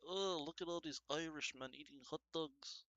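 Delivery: a hint of bemused soccer fans gawking; quoting the fans in a put-on voice; mock Irish fan. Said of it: "They're like".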